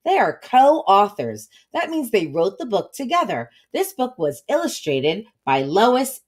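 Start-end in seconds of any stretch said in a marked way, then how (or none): none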